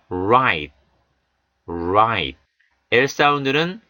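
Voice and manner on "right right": right with tongue tip curled back; curled r demo. Shown as a demonstration. Teacher modelling right with curled r. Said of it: "같이 한번 해보시죠".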